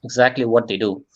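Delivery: usual speaking voice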